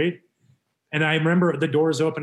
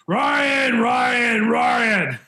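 high, loud shouted name; mock-holler the name like an angry boss; imitating yelling; 10 semitones higher, 6 dB louder, much slower than usual. Manager yelling at his player in the office, imitated yell. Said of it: "he's yelling at me".

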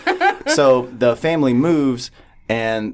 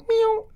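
meow. Retold or imitated. The cat's call imitated.

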